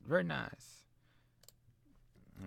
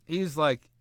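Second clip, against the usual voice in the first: usual speaking voice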